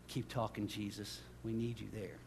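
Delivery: very soft, low prayerful aside; hushed plea. Real, not acted. Preacher urging Jesus to keep interceding, hushed and low. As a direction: plead quietly, almost to yourself.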